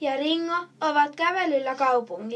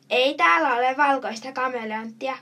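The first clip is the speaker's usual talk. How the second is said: usual speaking voice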